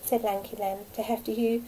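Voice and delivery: usual speaking voice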